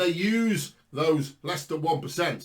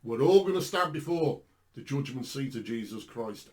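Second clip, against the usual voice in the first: ordinary talk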